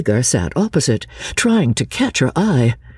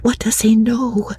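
high, hushed whisper; 8 semitones higher than usual. A narrator's reading. Lucy alone with her cousin at last, whispering. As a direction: whisper it anxiously, pitched high. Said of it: "whispered Lucy as soon as".

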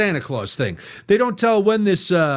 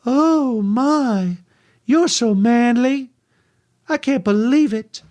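high, slow, swooning Southern belle; a lightly voiced Southern woman, impressed and gushing; 6 semitones higher, slower than usual. Southern woman swooning over a deer hunter, higher and slow. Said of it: "talking to a Southern woman".

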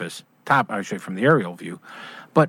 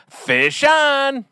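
a high, excited call of a hooked fish; excited yell; 14 semitones higher than usual. Acted. Ad voice calling out the fisherman's cry, high excited yell. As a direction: sing it out like you just hooked a salmon. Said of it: "get ready to yell".

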